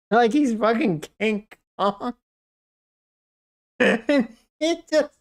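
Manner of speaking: talking through high-pitched cackles; laughing. Real, not acted. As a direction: barely get words out for laughing. Laughing hard mid-story.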